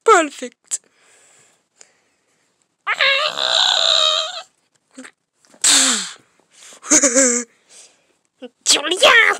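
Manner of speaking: ordinary delivery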